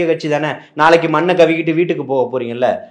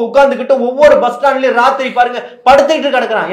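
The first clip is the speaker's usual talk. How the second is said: high, raised voice, demanding answers; impassioned challenge; 8 semitones higher, 6 dB louder than usual. Real, not acted. Party speaker demands a plan for delivery workers, impassioned. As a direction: throw the question back with rising outrage.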